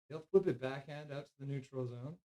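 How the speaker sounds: ordinary talk, nothing marked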